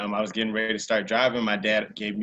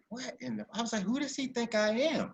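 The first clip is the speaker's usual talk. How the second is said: incredulous; 12 semitones higher, 6 dB quieter than usual. Retold or imitated. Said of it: "I'm like".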